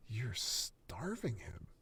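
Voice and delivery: whispered, slow. Acted. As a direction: say it under your breath. Kevin whispering to Ren.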